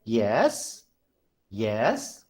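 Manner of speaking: questioning lift on YES; rising tone. Shown as a demonstration. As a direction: swoop YES up from a low start. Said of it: "question mark".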